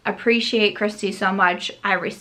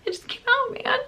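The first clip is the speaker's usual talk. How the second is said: very high, squeaky protest; whiny comic pleading in falsetto; mock-exasperated whine; 4 dB quieter than usual. Vlogger answering impatient commenters, mock-exasperated whine.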